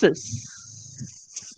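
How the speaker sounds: "s" as a soft, voiceless hiss; snake-like s. Shown as a demonstration. Teacher shows the sound "s", snake-like hiss. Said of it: "So it's like a s".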